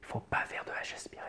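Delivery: whispered aside, all breath; H aspiré soufflé. Shown as a demonstration. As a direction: drop to a quick breathy whisper. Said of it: "tendance à faire un H aspiré". (chuchotement soufflé et rapide)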